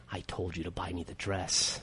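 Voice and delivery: a quiet, sinister little threat; creepy child. Retold or imitated. Dad imagining his daughter's threat, slow and hushed. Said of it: "She could be like".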